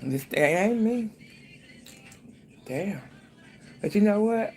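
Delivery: ordinary talk, nothing marked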